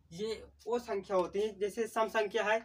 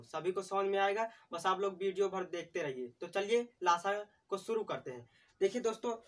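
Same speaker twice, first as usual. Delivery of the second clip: usual speaking voice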